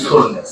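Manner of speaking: "চল্লিশ" said low as sollish; low-pitched "চল্লিশ" with s for ch; "চল্লিশ" opening on a dental s; s replacing ch. Shown as a demonstration. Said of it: "চ কে দন্ত স উচ্চারণ করবো".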